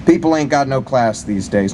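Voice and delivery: ordinary delivery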